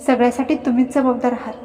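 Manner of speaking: plain reading voice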